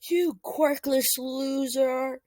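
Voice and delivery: drawn-out jeering shout, slow; mock bully yell. Bully kids taunting Deku, slow jeering yell. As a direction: sneer it like a schoolyard bully. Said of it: "a small mob of kids yelling at him".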